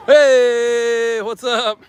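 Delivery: bright loud hey to passers-by; cheerful high greeting. Real, not acted. Greeting locals on the street, high and loud. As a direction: call out high and friendly.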